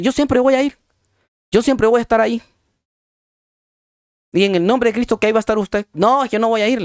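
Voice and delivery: retold insistence. Acted insisting and refusing, church invitation.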